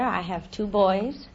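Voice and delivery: ordinary talk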